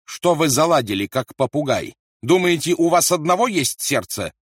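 loud, high furious rebuke; angry retort. A narrator's reading. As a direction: lash out at the soldier. Rolf lashes out at Gelmut. (гневная отповедь)

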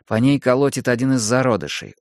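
ordinary delivery, nothing marked

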